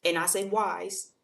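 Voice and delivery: slow, leaned-on wise; stress on wise. Shown as a demonstration. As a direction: dwell on the word wise. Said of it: "emphasis on wise".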